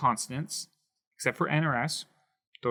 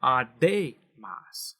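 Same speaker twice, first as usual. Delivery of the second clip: slow "A de mas" with DE stressed; stress on middle syllable; much slower than usual. Shown as a demonstration. Teacher showing the natural rule, A de mas stressed on DE. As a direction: punch the DE in A de mas, slowly. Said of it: "we would naturally place it right here".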